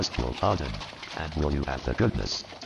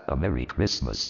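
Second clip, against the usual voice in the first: ordinary talk, nothing marked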